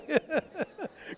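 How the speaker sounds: high rippling chuckle; real high giggle; amused laugh. Real, not acted. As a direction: laugh at your own story. Laughing at his own story.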